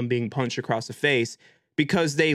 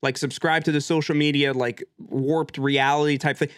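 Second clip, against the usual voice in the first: ordinary talk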